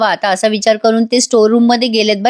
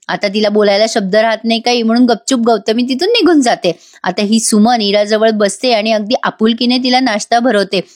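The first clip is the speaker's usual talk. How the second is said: usual speaking voice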